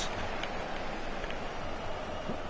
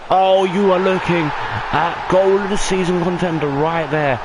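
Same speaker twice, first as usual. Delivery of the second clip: excited goal call; 9 semitones higher, 4 dB louder than usual. Real, not acted. Commentator hailing a goal of the season contender, excited shout. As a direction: erupt over a wonder goal, stretching the words.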